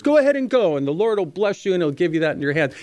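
sarcastic go-ahead, starting high and easing down; hint of sarcasm. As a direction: toss out a blessing you don't mean. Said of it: "I sense a hint of sarcasm in Micaiah's voice".

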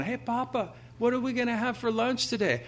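put-on high baby bird asking its papa. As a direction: play a hungry chick asking dad. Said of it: "the baby bird going".

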